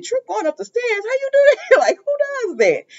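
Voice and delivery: high, disbelieving question; can't believe what he hears; incredulous. Retold or imitated. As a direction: Ridge disbelieving Brooke's excuse, high. Ridge incredulous at Brooke.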